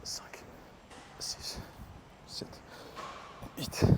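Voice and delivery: whispered rep count under effort; breathless counting. Real, not acted. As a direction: count the reps under your breath, straining. Counting reps during the exercise. (compter les répétitions à voix basse, essoufflé)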